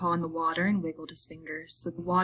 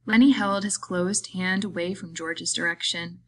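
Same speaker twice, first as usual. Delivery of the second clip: ordinary delivery, nothing marked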